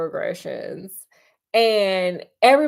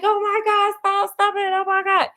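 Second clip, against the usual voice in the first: much higher, panicked pleading to stop; alarmed parents; 9 semitones higher than usual. Retold or imitated. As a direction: imitate shocked parents begging them to stop. Parents react to the fight.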